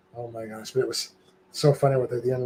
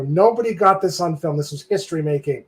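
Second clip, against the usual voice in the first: higher, louder mock dismay; retold outcry; 5 semitones higher, 4 dB louder than usual. Lamenting to friends that nobody filmed it, mock dismay. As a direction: exclaim it, voice raised.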